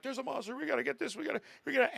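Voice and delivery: high-pitched frantic imitation; mock panic. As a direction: imitate someone raising the alarm in a high voice. Imitates panicking man warning crowd.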